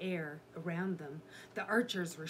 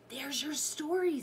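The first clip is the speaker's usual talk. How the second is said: raised, eager voice; urgent scholar; 9 semitones higher, 4 dB louder than usual. A narrator's reading. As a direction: point it out urgently, pitch up. Scholar urgently points out book.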